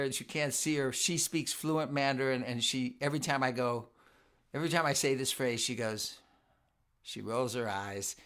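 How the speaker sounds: ordinary talk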